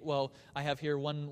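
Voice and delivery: normal talking voice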